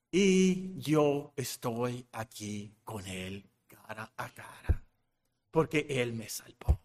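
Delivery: slow, low, fading almost to nothing; hushed awe. Acted. Believer face to face with God, hushed awe. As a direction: let the voice sink softly in wonder.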